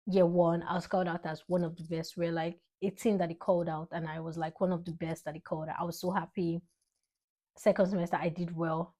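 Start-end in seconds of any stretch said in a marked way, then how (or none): none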